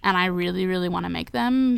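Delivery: ordinary talk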